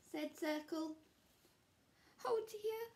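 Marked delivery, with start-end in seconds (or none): none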